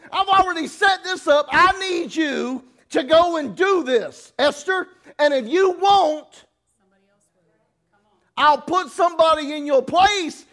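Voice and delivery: high, loud, stern voice of God; God scolding the listener, loud and high; booming God voice. Retold or imitated. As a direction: thunder it down as God. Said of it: "And, and God's going".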